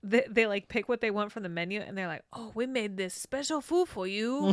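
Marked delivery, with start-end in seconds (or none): 2.3-4.5 mock Louisiana accent. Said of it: "this awful Louisiana accent".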